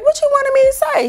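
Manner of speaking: high, playful teasing question; high mock-innocent comeback. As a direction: tease him, going along with the joke. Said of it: "going with the joke".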